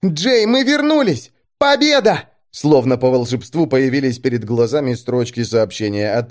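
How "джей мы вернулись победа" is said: excited cry. A narrator's reading. Elli's joyful message voiced.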